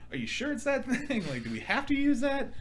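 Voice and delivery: high, anxious question with a laugh; nervous pleading. Retold or imitated. Seeing the glowing red tool, asking the doctor high and anxious. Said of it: "I was just like".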